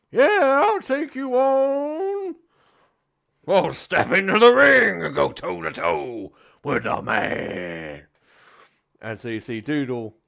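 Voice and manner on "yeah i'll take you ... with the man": put-on high, very slow, loud old man's challenge; an old man in his briefs taking up the fight; old challenger's cry. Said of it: "He goes".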